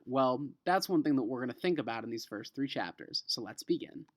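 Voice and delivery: normal talking voice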